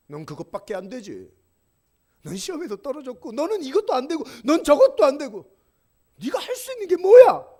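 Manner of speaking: scornful voices rising into a yell; mocking crowd.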